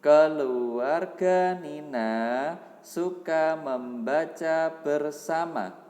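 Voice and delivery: slow, clear read-aloud voice; reading to children. Acted. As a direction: read it slowly for young pupils. Teacher reads text aloud to pupils. (membacakan cerita untuk anak)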